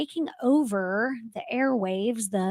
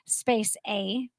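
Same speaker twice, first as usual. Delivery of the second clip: normal talking voice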